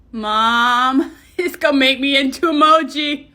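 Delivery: usual speaking voice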